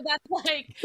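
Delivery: usual speaking voice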